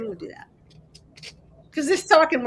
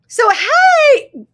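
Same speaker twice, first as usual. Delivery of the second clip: high, loud burst of greeting; excited hey; 11 semitones higher, 13 dB louder than usual. Real, not acted. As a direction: call out happily to a new arrival. Greets a viewer joining stream.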